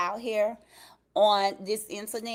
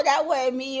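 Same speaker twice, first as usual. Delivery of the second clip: ordinary talk, nothing marked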